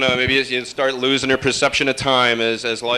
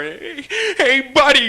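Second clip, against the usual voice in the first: guy who rear-ended me; 12 semitones higher, slower than usual. Retold or imitated. Driver shouting at me through my window, high and pushy. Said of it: "He goes".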